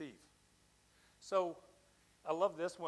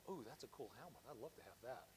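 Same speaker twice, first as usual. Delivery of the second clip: softer, hushed envious aside; hushed envy; 3 semitones lower, 14 dB quieter than usual. Retold or imitated. Firefighter next to me eyeing the helmet, hushed. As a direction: murmur it like you covet his helmet.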